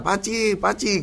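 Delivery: light sing-out of a name; imitated calling. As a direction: mimic calling a neighbour without real volume. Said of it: "llamando a Pachi".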